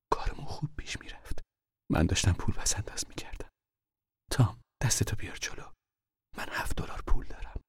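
urgent whisper in the dark; hushed whisper. Acted. Mother whispering warnings to her hiding son. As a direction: keep it breathy and secret.

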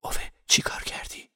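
whispered question; quiet asking. A narrator's reading. Parvaneh asks Ove aside, hushed whisper. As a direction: whisper it softly, just for him. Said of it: "پروانه آهسته پرسید".

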